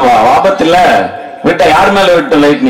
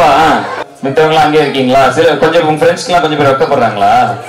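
normal talking voice

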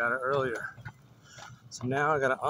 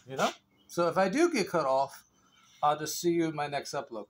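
ordinary talk

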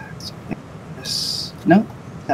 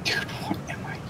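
whispering; 12 dB quieter than usual. Real, not acted. Muttering to self in confusion.

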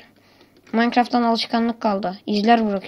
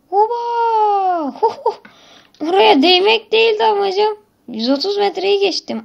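excited yell; 10 semitones higher, 4 dB louder than usual. Real, not acted. Wild crash, flying far.

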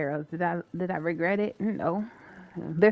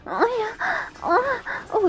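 excited squeal; 14 semitones higher, slower than usual. Real, not acted. Excited at game find.

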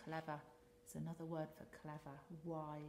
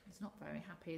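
ordinary delivery, nothing marked

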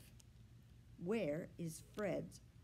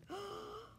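high sharp gasp of surprise; surprised gasp; 13 semitones higher, 6 dB quieter than usual. A narrator's reading. Boy seeing his lost dog return, surprised gasp. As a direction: gasp in delight.

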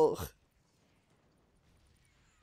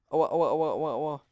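loud hammed-up ouch cries; mock pain cries; 10 dB louder than usual. Real, not acted. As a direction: yelp at every hit. Character hit in game.

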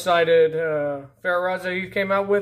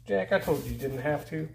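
normal talking voice

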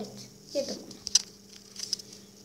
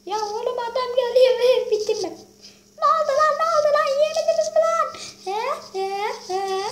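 much higher and louder, frantic; panicked high yelling; 12 semitones higher, 5 dB louder than usual. Real, not acted. Kid gamer yells at chasing players, panicked and high. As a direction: shout in alarm as the enemy closes in.

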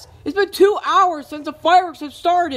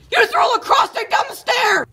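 high, loud outraged yell; angry shout; 10 semitones higher, 3 dB louder than usual. Acted. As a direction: yell it at whoever threw the stick. Lacrosse stick thrown down stairs.

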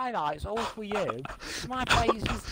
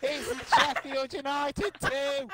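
stretched-out, disbelieving shout; slow, laughing commentator exclamations; elated goal celebration; 3 semitones higher, slower than usual. Real, not acted. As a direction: draw out every word in joyful disbelief. Mock commentary celebrating late goal.

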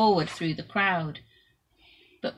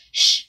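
shush; 10 dB louder than usual. A narrator's reading. Crowd hushing the beggar.

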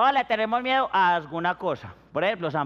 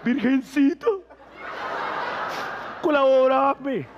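mock prayer; 4 semitones higher, slower than usual. Mock prayer from scared contestant.

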